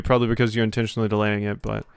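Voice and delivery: usual speaking voice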